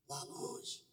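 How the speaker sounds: hushed, breathless whisper. Retold or imitated. Telling the church he saw her far off, whispered. Retelling first sight of Nicole.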